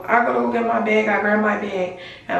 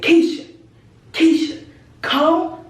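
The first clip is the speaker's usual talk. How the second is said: calling out; 8 semitones higher, 3 dB louder, slower than usual. Retold or imitated. Calling friend outside angrily.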